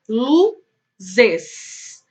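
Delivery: "luzes" as "lu", long pause, "zes"; split into syllables. Shown as a demonstration. Teacher asking pupils to count, "luzes" syllable by syllable. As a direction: break "luzes" into two drawn-out beats. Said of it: "separar as palavras em sílabas".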